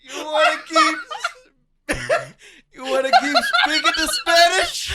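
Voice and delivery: high, loud, helpless laughter through the words; laughing. Real, not acted. Teasing friend about his accidental accent, laughing hard.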